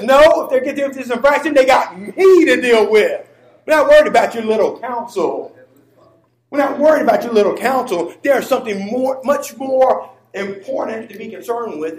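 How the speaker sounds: high, loud pulpit rebuttal; impassioned preacher overruling the council; full-force preaching. Real, not acted. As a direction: thunder God's answer to the congregation.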